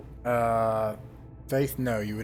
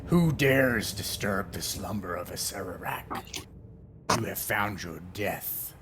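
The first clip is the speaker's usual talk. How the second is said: grinding stone statue; 2 semitones lower, slower than usual. Acted. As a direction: let each word grind out slow and heavy like an ancient stone lich waking. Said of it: "and it's like".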